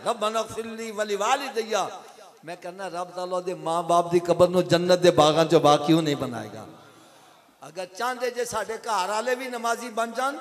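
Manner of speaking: loud, driving sermon delivery, pitch lifted; fervent preaching. Acted. Preacher urging listeners toward prayer, fervent and loud. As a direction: preach it out with rising force.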